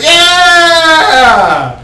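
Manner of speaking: high, loud crowd yeah; a cheering mob. Acted. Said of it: "The crowd's like".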